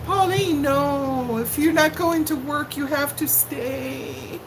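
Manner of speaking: a mock-tearful plea to the departing viewer; mock pleading. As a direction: beg her to stay, hammed-up. Viewer Pauline leaving the stream.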